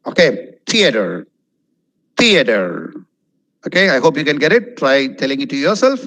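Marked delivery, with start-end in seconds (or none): 0.7-2.7 "Theater" with a clear th, "thee-uh-ter"; careful "Theater"; correct pronunciation. Shown as a demonstration. Teacher modelling the word. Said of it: "Next is not theater".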